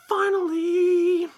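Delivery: drawn-out, high, loud cheer; excited shout. Real, not acted. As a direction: stretch it out in pure triumph. Overjoyed about the Blu-ray release.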